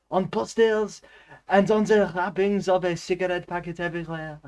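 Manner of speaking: high-pitched, hammed-up French accent; comic French narrator voice. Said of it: "I can do the French accent".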